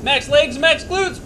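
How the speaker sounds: loud high urgent command; shouting coach. Real, not acted. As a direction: bark it to push him through. Coach shouting during g-force run.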